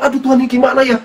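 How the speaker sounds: high-pitched fretting cry; worried, pleading high voice; anxious. Acted. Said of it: "dengan rasa yang".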